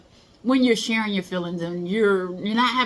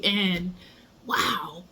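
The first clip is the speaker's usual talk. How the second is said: normal talking voice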